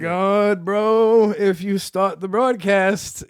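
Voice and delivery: high, loud, drawn-out disbelief; hammed-up, stretched-out freak-out; acted alarm. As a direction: exclaim it to a buddy in mock horror. Acting alarm on finding it online.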